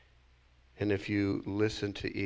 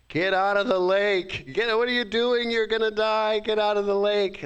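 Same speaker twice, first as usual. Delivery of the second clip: high, loud panicked shout to the duckling; frantic mother cat voice, high and loud; cat yelling; 13 semitones higher, 6 dB louder than usual. Acted. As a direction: yell at the duckling to get out of the water. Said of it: "And the cat says".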